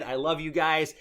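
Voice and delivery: usual speaking voice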